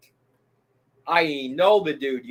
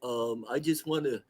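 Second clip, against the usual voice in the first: lower, softer mock earnest address; mock serious announcement; 4 semitones lower, 9 dB quieter than usual. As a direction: play a streamer going all serious. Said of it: "on all serious. He goes".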